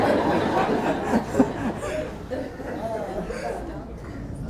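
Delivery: high unvoiced burst of laughter; breathy laugh. Real, not acted. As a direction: let a silent laugh escape. Bursts laughing after rant.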